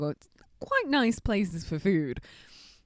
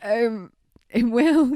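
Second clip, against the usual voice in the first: a hint of Freddy Krueger; 3 semitones higher, 7 dB louder, much slower than usual. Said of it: "You've gone all Freddy Krueger on me now".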